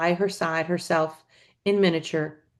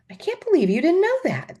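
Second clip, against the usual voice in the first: higher, incredulous; a touch of disbelief; 12 semitones higher than usual. A narrator's reading. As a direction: sound lightly amazed. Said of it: "the Iron Woman said".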